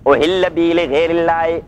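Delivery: slow, drawn-out chanted recitation; slow Quran recitation. Shown as a demonstration. Teacher reciting the verse to his students, slow chanted recitation. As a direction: intone the verse slowly, stretching each word.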